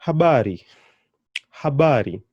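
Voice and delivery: Habari with a loud, lengthened middle BA; stress on ba. Shown as a demonstration. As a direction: lean on ba in Habari, falling away on ri. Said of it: "my ba is where I'm putting the emphasis on".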